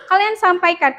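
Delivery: ordinary talk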